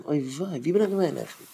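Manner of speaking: soft, mildly dismayed muttering to oneself; worried self-questioning. Acted. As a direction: think aloud quietly, racking your memory. Acted worried recalling of yesterday. (שטיל, א ביסל באזארגט, צו זיך אליין)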